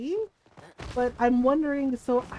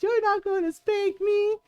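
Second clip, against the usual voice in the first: high, bratty little brother taunting; defiant bratty kid; 8 semitones higher than usual. Retold or imitated. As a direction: taunt mom from a higher pitch. Brother defies mom in public.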